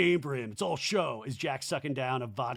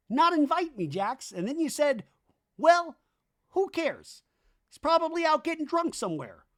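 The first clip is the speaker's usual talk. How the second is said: much higher aggrieved buddy voice; Danny impression; 12 semitones higher, 4 dB louder than usual. Danny confronting Jax about parties, high aggrieved voice. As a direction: whine it high like a hurt friend left out. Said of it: "Danny goes".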